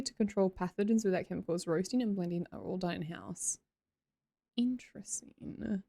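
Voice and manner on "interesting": whisper. Real, not acted. Musing after reading coffee blurb.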